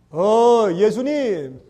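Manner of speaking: mocking devil. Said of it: "빈정거리죠".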